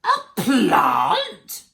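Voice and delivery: high, loud shriek of disbelief; horrified yell. A narrator's reading. Mum hears he'd rather eat a plant, horrified yell. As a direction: yell it as if scandalised. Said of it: "yelled Mum in horror".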